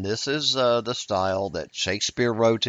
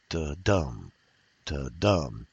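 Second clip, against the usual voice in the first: low "Da-dum", DUM stressed and drawn out; unaccented then accented; 6 semitones lower, slower than usual. Shown as a demonstration. Teacher showing students an iambic foot, low "Da-dum" stressed on DUM. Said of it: "an unaccented and accented syllable".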